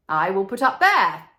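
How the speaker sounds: loud pleased call; cried out. Acted. Swallow spotting the statue, loud cry. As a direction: call out the choice. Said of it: "he cried".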